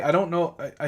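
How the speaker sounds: usual speaking voice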